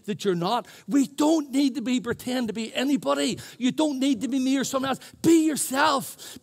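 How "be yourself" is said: slow, loud and higher preached cry; preached shout. Real, not acted. Preacher urging the congregation to be themselves, loud and slow. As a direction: call it out to the church, drawn out. Sermon climax, shouted exhortation.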